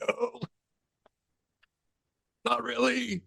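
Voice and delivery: high squeaky hammed-up panic, rushing out; backing away from the ghost box in mock terror. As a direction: play scared and bolt for the door. Mock panic fleeing the spirit.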